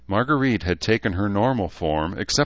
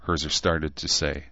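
usual speaking voice

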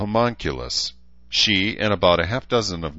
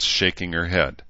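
ordinary delivery

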